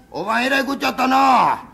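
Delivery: a fool shouting fire-visit wishes at a funeral; loud, clueless condolence. Acted. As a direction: bellow it like cheering someone up. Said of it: "おもろい人やな。".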